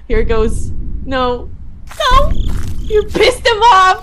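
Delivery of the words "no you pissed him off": high, loud, dragged-out protest; loud, high-pitched dismay; alarmed yell. Real, not acted. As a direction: yell in panic at the player. Player angered the monster.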